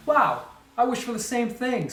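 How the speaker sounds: bright, high, delighted wow; light and thrilled, a smile in the voice; smiling, excited. A narrator's reading. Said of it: "smiled Frogchild".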